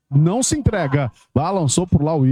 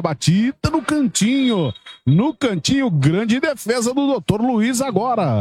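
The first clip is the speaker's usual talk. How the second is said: excited commentary; 6 semitones higher than usual. Real, not acted. Near goal, big save.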